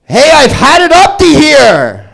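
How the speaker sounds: high, slow, loud New York "Hey" yell; New Yorker impression. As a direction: belt it like a cabbie leaning out the window. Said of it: "that teenager's parents from New York".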